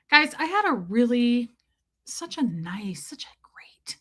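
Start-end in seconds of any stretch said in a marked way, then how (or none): 2.0-4.0 breathy hushed whisper, slowing down. Real, not acted. Telling the chat a secret in a whisper.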